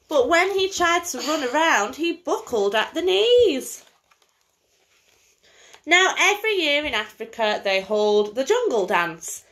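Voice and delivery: lively bedtime story reading; singsong picture-book voice for a little girl; reading to a child. Acted. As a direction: read it like a storybook to a small child. Mother reads picture book aloud.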